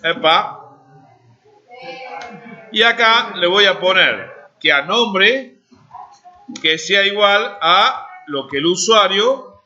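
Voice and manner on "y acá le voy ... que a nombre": normal talking voice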